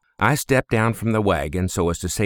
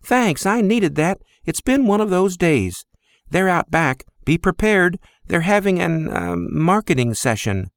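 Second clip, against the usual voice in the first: higher, friendly woman's voice with a weary sigh in it; Thena, woman's voice; 9 semitones higher than usual. Acted. Thena warns Sock about the family, higher friendly woman's voice. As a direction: warn him kindly about the family.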